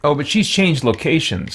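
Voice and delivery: ordinary delivery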